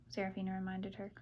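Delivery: ordinary delivery, nothing marked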